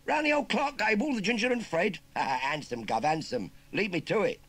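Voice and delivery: high, loud cheeky Cockney lad; chirpy London street kid rattling off slang; perky Cockney urchin. Acted. Urchin asks farmer about the job in Cockney.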